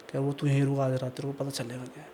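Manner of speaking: a lightly voiced menacing spirit; ominous ghost voice. Ghost threatens the trapped man.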